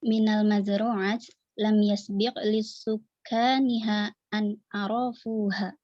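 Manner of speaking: ordinary talk, nothing marked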